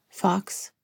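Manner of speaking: gentle, hushed name; said softly. A narrator's reading. Counselor interrupting her client's self-blame, softly. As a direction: softly cut in with his name. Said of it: "she said softly".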